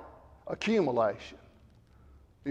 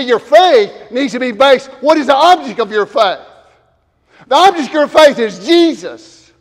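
louder, higher, fervent sermon climax; preaching at full force; 6 semitones higher, 7 dB louder than usual. Acted. Pastor assuring the congregation, fervent preaching. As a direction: preach it as a promise to the church.

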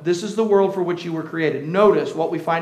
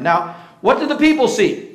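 ordinary delivery

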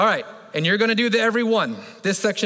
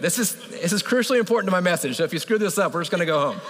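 normal talking voice

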